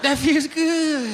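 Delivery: high, drawn-out mock moan of delight; moaning pleasure.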